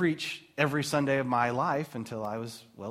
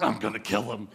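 lower, mock-fed-up wife voice; lightly voiced exasperated wife; 6 semitones lower than usual. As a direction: play the wife at her wit's end. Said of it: "Kelly's like".

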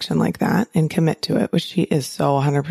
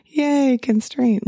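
sarcastic yay; 7 semitones higher, much slower than usual. Retold or imitated. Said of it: "And I know that's kind of like".

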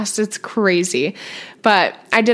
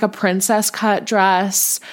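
ordinary delivery

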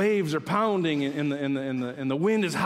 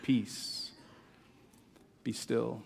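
quiet calming refrain; 2 semitones lower, 6 dB quieter, much slower than usual. Acted. Quiet refrain after darkness vanished.

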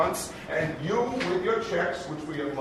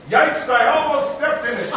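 title proclaimed high and loud; announced title; 10 semitones higher, 9 dB louder than usual. Shown as a demonstration. Host proclaims the show title loudly to the crowd. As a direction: sell "Yikes, I almost stepped in it." like a marquee. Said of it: "title of that show".

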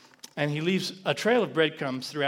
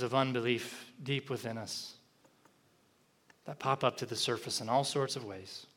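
closing prayer; 4 semitones lower, 5 dB quieter than usual. Acted. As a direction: pray quietly with long pauses.

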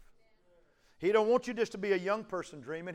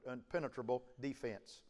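ordinary delivery

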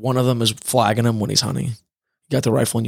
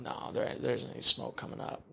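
hushed; 14 dB quieter than usual. Retold or imitated. Quoting reaction to lightning strike.

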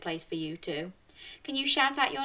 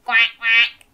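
quacking duck; 3 semitones higher, 12 dB louder than usual. Shown as a demonstration. Host quacks like a duck for the children, loud. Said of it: "Can you go quack".

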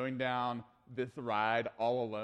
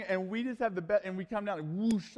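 normal talking voice

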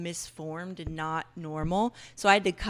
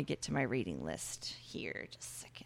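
whisper; 9 semitones lower, 10 dB quieter than usual. Real, not acted. Muttering while searching reading list.

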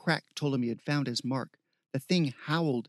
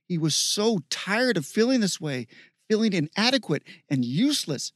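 raised pitch, heated narration; worked-up, higher-pitched telling; mounting frustration; 5 semitones higher, 4 dB louder than usual. A narrator's reading. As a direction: narrate as if fed up with yourself.

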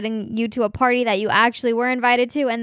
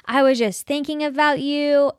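higher, slower, delighted dad voice; dad answering the phone; 4 semitones higher, slower than usual. Retold or imitated. Dad answering his daughter's call, imitated higher and delighted. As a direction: imitate dad picking up, thrilled to hear from her. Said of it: "He's like".

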